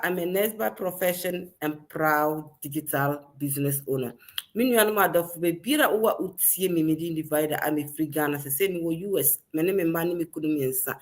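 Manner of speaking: ordinary delivery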